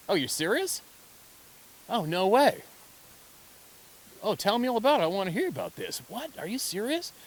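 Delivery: high, loud excited curiosity; eager amazement. Acted. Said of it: "they react by going".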